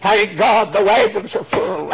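ordinary talk

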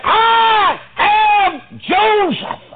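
very high, loud, very slow proclamation; shouted reveal. Retold or imitated. Joseph revealing himself to his brothers, shouted slow proclamation. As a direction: ring the name out like a trumpet.

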